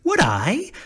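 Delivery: high, loud eager question. A narrator's reading. Scarecrow thrilled at the offer of kites, eager. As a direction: jump at the offer.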